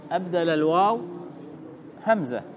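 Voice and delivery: ordinary talk, nothing marked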